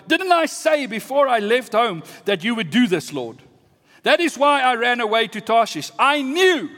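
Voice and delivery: high, heated, indignant protest; angry complaint. Acted. Jonah complains to the Lord, angrily. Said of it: "he became very angry".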